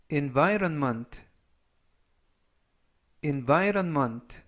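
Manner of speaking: slow, carefully stressed "environment" with the stress on VY; pronunciation model. Shown as a demonstration. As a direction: stretch out "environment" syllable by syllable. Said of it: "environment라고 발음하시면".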